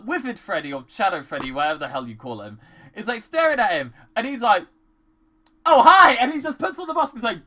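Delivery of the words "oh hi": high, loud mock-casual hello; sudden loud, high greeting; startled greeting. Mike noticing Freddy staring at him, startled greeting. Acts character surprised by Freddy.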